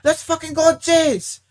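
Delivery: very high, loud, drawn-out cheering scream; manager's hype yell. Retold or imitated. Manager joins in cheering the Jays, loud high yell. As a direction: mimic the boss suddenly losing it with joy. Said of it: "And he's like".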